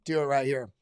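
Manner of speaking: ordinary talk, nothing marked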